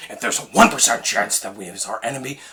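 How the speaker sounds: Ben Affleck Bruce Wayne. Retold or imitated. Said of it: "Do Ben's Bruce Wayne".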